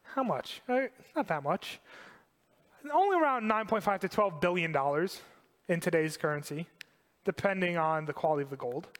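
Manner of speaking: ordinary talk, nothing marked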